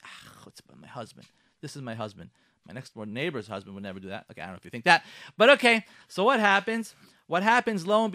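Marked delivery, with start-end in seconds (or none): 0.0-4.3 muttering grumble. Pretend. Wife grumbling to herself.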